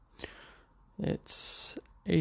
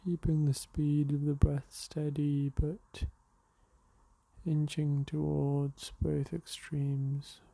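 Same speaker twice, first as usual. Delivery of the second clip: unhurried meditation voice with long pauses; guided meditation; slower than usual. Real, not acted. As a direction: guide them gently into the breath, leaving space between phrases. Leads a morning meditation.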